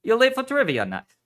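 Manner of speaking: attempted Irish accent, high and loud. A narrator's reading. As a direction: scold cheerfully in a shaky-attempt Irish accent. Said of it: "he said in a heavy Irish accent".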